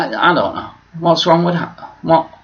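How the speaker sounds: normal talking voice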